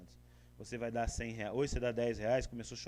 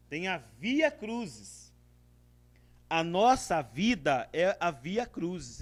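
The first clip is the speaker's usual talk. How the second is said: slow; 7 dB louder, much slower than usual. Acted. Preacher slows for solemn point.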